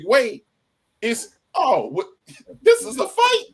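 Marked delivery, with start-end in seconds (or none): 1.5-3.4 sudden alarm. Retold or imitated. Escalation to fight.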